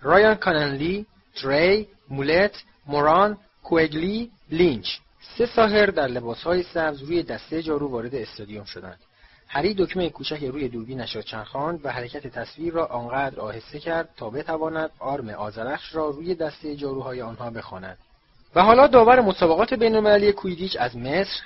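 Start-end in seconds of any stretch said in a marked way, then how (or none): 0.0-4.9 louder, each name set apart; stadium announcer. A narrator's reading. Commentator naming the team to the crowd, announcing loudly. 18.5-21.4 loud, high-pitched introduction; stadium announcer. A narrator's reading. Commentator introducing the referee from Egypt, announcing loudly.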